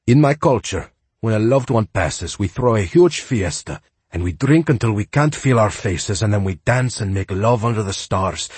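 put-on joyful wise groundskeeper, Spanish accent; high and lively, heavy Spanish accent. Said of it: "with a heavy Spanish accent".

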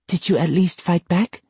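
pushing little brother; older sister voice. Acted. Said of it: "Kimiko asked".

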